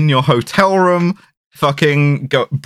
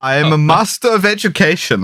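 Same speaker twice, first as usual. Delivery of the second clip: laughing mock German villain; German accent; 4 semitones higher than usual. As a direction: parody the hitman in German accent. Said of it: "parodying Kaufman".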